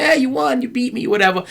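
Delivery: high-pitched imitation of a dad conceding; mock cheering. Father retold cheering son's pretend win.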